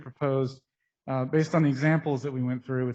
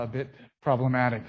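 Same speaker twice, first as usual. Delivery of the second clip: ordinary talk, nothing marked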